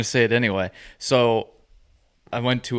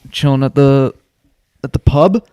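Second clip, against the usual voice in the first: loud, higher teasing question; 4 semitones higher, 11 dB louder than usual. Real, not acted. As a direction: tease him about the pub. Teasing question to hungover friend.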